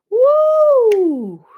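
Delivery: long, high, loud "woo"; exuberant whoop. Real, not acted. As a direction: let out a big celebratory whoop. Speaker cheers after forgiveness point.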